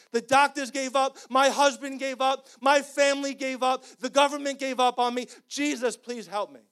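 loud, high, impassioned preaching; full-force preaching. Acted. Pastor preaching hard to the congregation, loud and impassioned.

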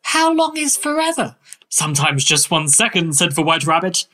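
airy, whimsical Victorian author; dreamy storybook eccentric, slightly lifted; Lewis Carroll. Acted. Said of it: "that, uh, performance".

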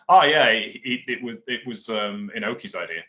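retold blurt, loud on the first words. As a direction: let it burst out, imitating a careless slip. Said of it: "they just blurted out".

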